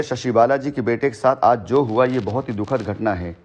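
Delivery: ordinary talk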